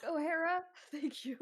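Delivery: put-on high, shaky teen voice with a nervous laugh; nervous girl. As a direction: repeat it gratefully, laughing nervously. Girl repeats name, nervous laugh.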